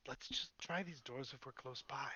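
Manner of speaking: soft, slow whisper. Acted. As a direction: hush it so the guard won't hear. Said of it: "I'll whisper".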